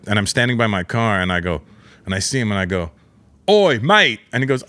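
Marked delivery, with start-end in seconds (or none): 3.5-4.2 loud, high Cockney-style oi, mate; hammed-up English accent; mock English greeting. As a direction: call across the lot like a London bloke. Greeting Russell Brand, mocking Englishness.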